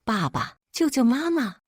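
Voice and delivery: put-on mildly tearful child's plea, lifting high at the end; a small girl calling for help, understated; gently voiced pleading cry. A narrator's reading. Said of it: "菲菲哭着喊".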